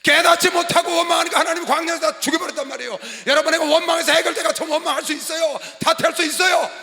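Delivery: loud, relentless pulpit shout; full-force preaching. Acted. Pastor warning congregation against blame, shouted preaching. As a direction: drive each point home to the congregation.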